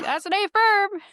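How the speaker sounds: high, loud mock radio reply; hammed-up emphatic confirmation over the radio; imitated radio confirmation.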